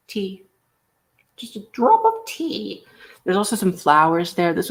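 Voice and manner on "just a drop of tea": dainty fancy voice. Acted. Dainty fancy-lady aside.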